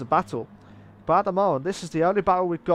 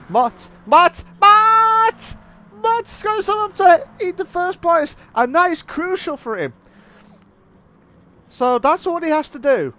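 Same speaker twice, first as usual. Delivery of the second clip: high, loud calls climbing to a yell, then urgent commentary; excited shouting; 9 semitones higher, 6 dB louder than usual. Real, not acted. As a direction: erupt at the overtake and stay fired up. Commentator shouts as lead changes.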